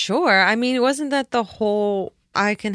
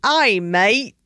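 put-on louder, cheeky Australian accent; mock Australian accent; 6 dB louder than usual. Answering her cohost in a mock Australian accent. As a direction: answer the call like a jokey Aussie. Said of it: "Is there an Australian here?".

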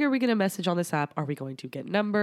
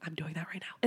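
breathy mock whisper; 10 dB quieter than usual. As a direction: whisper it like a secret. Mocking harmonizing videos.

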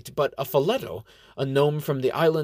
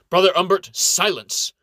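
commanding shout; 8 semitones higher, 7 dB louder than usual. A narrator's reading. Inquisitor silencing the quarreling monk with a loud command. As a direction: cut him off hard. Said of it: "Inquisitor Sheen cuts him off".

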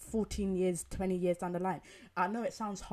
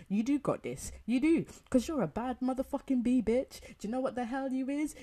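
loud, higher, fired-up hype; hyped-up cheer for a friend; 6 semitones higher, 7 dB louder than usual. Retold or imitated. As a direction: pump your girl up at full volume. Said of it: "I'm like".

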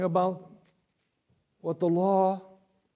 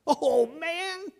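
awed; 12 semitones higher than usual. Acted. Preacher voices excited Peter.

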